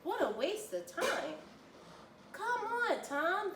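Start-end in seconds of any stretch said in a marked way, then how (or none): 2.3-3.5 high, eager cheer; crowd cheering. A narrator's reading. The crowd urges Tom toward the finish, cheering high. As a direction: urge him on from the sidelines. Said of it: "the crowd cheered".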